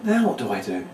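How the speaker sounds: soft, lost question; quietly stumped, thinking aloud; genuine puzzlement. Shown as a demonstration. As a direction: sound as if you truly don't know. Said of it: "get that emotion of being genuine".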